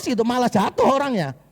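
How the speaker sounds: normal talking voice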